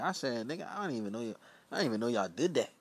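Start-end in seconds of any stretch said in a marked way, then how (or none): none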